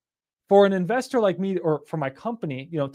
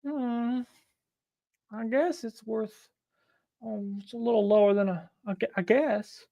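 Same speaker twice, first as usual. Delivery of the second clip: reluctant seller; 4 semitones higher, 7 dB quieter than usual. Retold or imitated. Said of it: "she's gonna be like".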